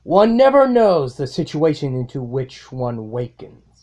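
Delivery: measured, grave inner monologue; solemn self-narration. Acted. Villain narrating his own rebirth, grave and measured.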